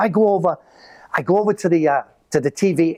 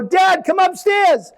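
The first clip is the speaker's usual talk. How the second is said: put-on shouting kid; 12 semitones higher, 3 dB louder, slower than usual. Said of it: "I go".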